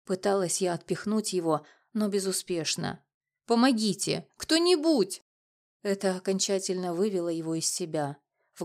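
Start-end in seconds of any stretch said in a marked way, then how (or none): 3.5-5.2 higher, raised desperate call; alarmed, high-pitched plea; cry for help. A narrator's reading. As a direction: call out for anyone, pitch leaping up. Calls for help under attack.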